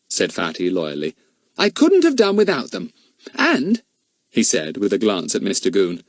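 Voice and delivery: a hint of a cheerful, loyal boy; bright, proud schoolboy voice; lightly voiced boy. A narrator's reading. Fatty praises his friends to the inspector, lightly voiced.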